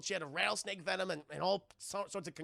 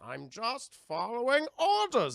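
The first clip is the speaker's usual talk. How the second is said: slow, louder, higher lumbering brute; dumb Bane voice; 4 semitones higher, 5 dB louder, slower than usual. Retold or imitated. Mocking Bane repeating orders, slow hammed brute voice. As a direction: drag it out like a dim henchman.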